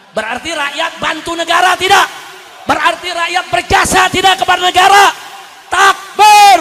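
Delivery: high, very loud shouted questions; full-force preaching. Real, not acted. Preacher rallying the crowd about taxpayers' service, shouted preaching. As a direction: rouse the crowd to answer back.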